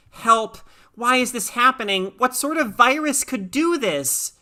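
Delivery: high, frightened pleading; panicked cry. A narrator's reading. Lisa frightened by her flaring vision, crying for help.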